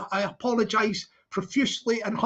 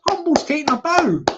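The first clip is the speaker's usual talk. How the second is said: high puppet voice; 5 semitones higher, 6 dB louder, slower than usual. Acted. Puppet voice proud.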